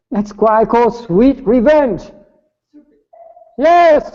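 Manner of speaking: gleeful emphasis. Real, not acted. Punchline on sweet revenge.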